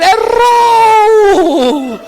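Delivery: very high, loud, drawn-out holler; hammed-up game-show wrong-answer cry, last vowel held long; comically huge announcer yell; mock buzzer shout. Eu ouvi ele falando.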